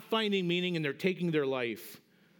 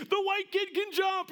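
much higher, slower mock crowd cheer; 13 semitones higher than usual. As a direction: imitate the stands going wild. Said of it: "It was like".

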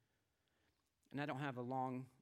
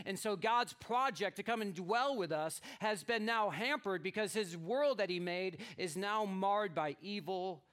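raised, louder preaching voice; 7 semitones higher, 9 dB louder than usual. Acted. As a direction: proclaim the story to the congregation. Preaches on the rebellion.

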